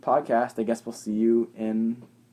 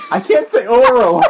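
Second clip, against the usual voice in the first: laughing; 10 semitones higher, 11 dB louder, slower than usual. Real, not acted. Laughing at his own flub.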